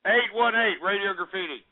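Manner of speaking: ordinary talk, nothing marked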